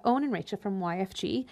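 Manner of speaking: normal talking voice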